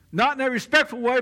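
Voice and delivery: normal talking voice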